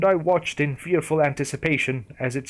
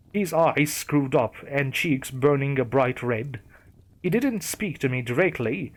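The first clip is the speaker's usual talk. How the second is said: plain reading voice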